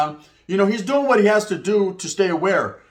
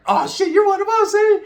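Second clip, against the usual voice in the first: high, pitched-up amazed exclamation; mock surprise; 14 semitones higher, 4 dB louder than usual. To the new cellmate, learning he's one of them, in high mock surprise. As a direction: squeal it out like a happy surprise. Finds out he's a fellow norteño.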